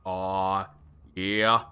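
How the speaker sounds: low, halting try at Australian; half-hearted Australian practice; shaky Australian accent attempt. Shown as a demonstration. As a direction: feel for an Australian accent and miss. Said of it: "my shitty Australian accent".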